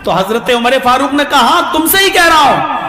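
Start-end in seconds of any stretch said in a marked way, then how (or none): 1.4-2.8 firm insistence. Acted. Umar insists.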